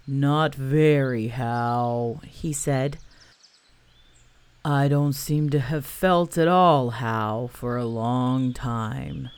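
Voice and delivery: low, very slow gloomy donkey voice. Acted. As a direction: mope it out like a sad old donkey. Eeyore replies gloomily.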